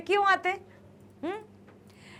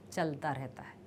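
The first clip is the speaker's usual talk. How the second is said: normal talking voice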